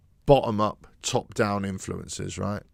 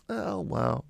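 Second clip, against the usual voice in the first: higher, softer mock shrug; 6 semitones higher, 6 dB quieter than usual. Pundit imitating a player shrugging, mock resigned. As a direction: throw it away with raised hands. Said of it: "holding up my hands going".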